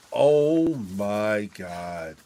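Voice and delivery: very slow, drawn out; dawning relief. Retold or imitated. Realizing the wife was home all along, slow relief. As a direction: let it sink in slowly. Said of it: "I'm like".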